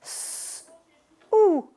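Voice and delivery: "S" as a long hiss, pause, then "ou"; sons isolés. Shown as a demonstration. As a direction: sound out "S, ou" one sound at a time. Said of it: "pour écrire". (« S, ou » son par son, ssss… ou)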